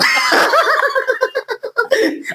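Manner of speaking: squealing high giggle; a shrill feminine laugh; high girly laugh. Pretend. Laughs in put-on female voice.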